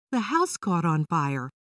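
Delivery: ordinary delivery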